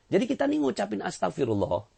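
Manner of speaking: ordinary delivery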